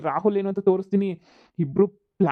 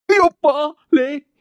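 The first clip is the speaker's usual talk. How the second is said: pained yelp; 7 semitones higher, 7 dB louder, slower than usual. Acted. Husband hit again.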